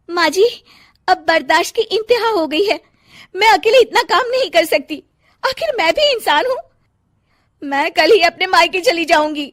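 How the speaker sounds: high, loud exasperated complaint; fed-up outburst. Acted. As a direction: threaten to leave for parents' home. Wife at limit complains to mother-in-law.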